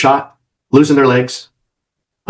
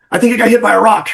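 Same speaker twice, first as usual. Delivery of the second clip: high, bewildered report; dazed boss; 9 semitones higher, faster than usual. Retold or imitated. Shot boss radioing in, high dazed voice. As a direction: sound stunned and unsure. Boss holding his chest.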